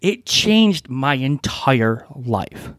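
slow, weighty, each word landed; emphatic repeat. Shown as a demonstration. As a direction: stomp on every word, slowly. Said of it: "I'm gonna emphasize that one more time".

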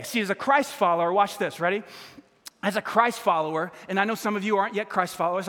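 usual speaking voice

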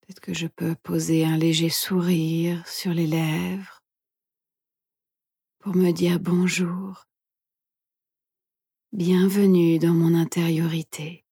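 soft, hushed meditation guide; guided meditation whisper. Acted. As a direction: whisper gently, leaving long pauses.

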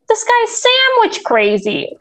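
very high, drawn-out mock impression; silly catchphrase voice. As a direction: stretch it out in a silly high put-on voice. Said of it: "does not even say it in that voice".

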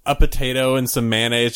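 ordinary talk, nothing marked

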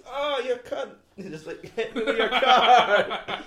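high, loud mock yell; retold outrage. Imitating [name] after the car crash, mock outraged. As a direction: yell it in comic outrage, half laughing.

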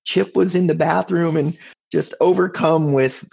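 ordinary delivery

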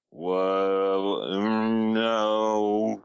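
drawn-out disbelief. Retold or imitated. Retold drawn-out reaction to excuse.

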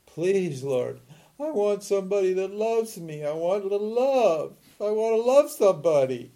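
high-pitched, hammed-up begging for love; mock pleading prayer. Teacher mimicking someone praying for a relationship, mock pleading. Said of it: "have you prayed for a relationship".

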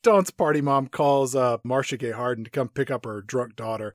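ordinary delivery

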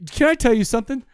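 normal talking voice

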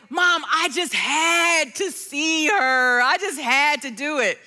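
drawn-out, mock-desperate teenage pleading; slow, hammed-up desperate confession; breaking-down plea. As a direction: imitate a son confessing, dragging out the words. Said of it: "he kinda broke down".

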